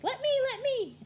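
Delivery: high excited squeal; high-pitched pleading kid voice; eager state voice. Acted. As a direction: beg to go first, squeaky and high. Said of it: "And then Ohio says".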